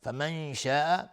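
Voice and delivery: slow chanted tajweed reading; Quran recitation. Shown as a demonstration. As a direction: recite it slowly, as in tilawa. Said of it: "tajweed demo of the phrase". (تلاوت آرام با تجوید)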